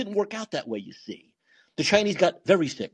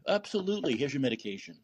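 quiet, soothing wrap-up; soft placating doctor; 2 semitones lower, 8 dB quieter than usual. Pretend. Asylum doctor ending with medication, softly soothing. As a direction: hand over the pills gently. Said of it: "Like doctors in a crazy house do".

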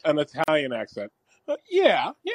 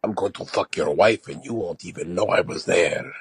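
low, menacing mock Spanish seducer; Spanish-accented bee; 7 semitones lower than usual. Cartoon bee bragging to a husband, low Spanish accent. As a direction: brag in a deep Spanish accent. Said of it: "getting out of Spanish now".

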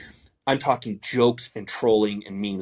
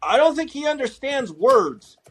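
much higher, louder mock quote; mocking Dasha Burns; 12 semitones higher, 4 dB louder than usual. Mocking a reporter's line about Fetterman, high and loud. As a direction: mimic her in a high, pointed voice. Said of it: "where Dasha Burns just goes".

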